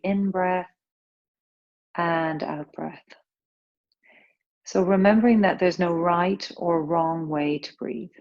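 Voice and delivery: calm, unhurried breath-awareness guidance; yoga teacher easing the class into breathing; guided meditation. Acted. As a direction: gently guide their attention through each breath. Leads a breath awareness practice.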